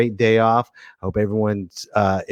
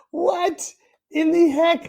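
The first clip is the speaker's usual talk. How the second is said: high exasperated what; slower than usual. Real, not acted. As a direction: squeak it out in disbelief. Exasperated at camera.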